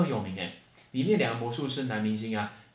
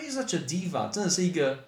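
exasperated remark; 6 semitones higher than usual. Acted. Teacher mimics griping about a difficult big shot, exasperated. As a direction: complain about a prima donna, stretching it out.

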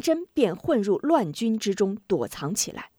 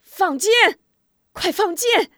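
high, loud battle command; urgent commander's shout; shouted order; 12 semitones higher, 6 dB louder than usual. A narrator's reading. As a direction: yell the order to the archers. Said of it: "大喊".

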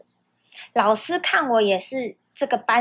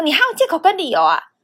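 higher, louder sneer, falling at the end; mocking teacher sneer; 5 semitones higher, 4 dB louder than usual. Teacher repeating his usual line at her, sneering. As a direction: parrot the teacher's stock line. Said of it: "完完全全的S O P照着演".

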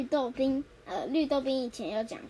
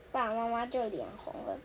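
ordinary delivery

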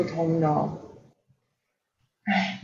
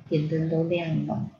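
usual speaking voice

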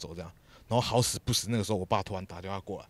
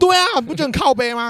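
much higher, louder indignant outcry; outraged; 15 dB louder than usual. Real, not acted. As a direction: demand agreement in disbelief. Indignant outburst to friend.

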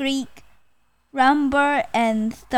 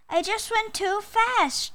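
high, lightly sighed excuse; sighing tiger; 9 semitones higher than usual. Acted. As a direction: excuse yourself as a storybook tiger.

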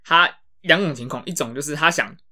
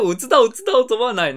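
high, louder mock dad protesting he knows; flustered insisting dad; 8 semitones higher, 4 dB louder than usual. Imitating dad to a friend, high and flustered insisting. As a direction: squeak out the insistence like a cornered dad.

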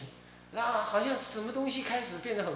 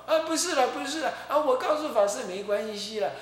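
higher, hurried mock protest; flustered denial; 8 semitones higher than usual. Nun caught out, protesting to the master in a mock fluster. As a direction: imitate her scrambling to say no.

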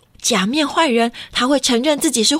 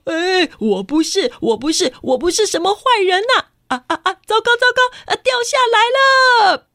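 flustered baddie denying, then falling; hammed-up villain in a panic; panicking villain; 6 semitones higher than usual. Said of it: "假面坏人捂住他的耳朵说".

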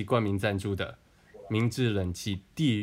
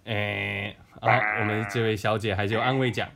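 ordinary delivery

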